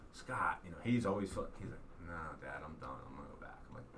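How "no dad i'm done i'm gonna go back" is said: low, soft imitation of a tired kid; mock worn-out son, dropped in pitch and volume; retold weary refusal. Tired son telling dad he's done, retold weary refusal.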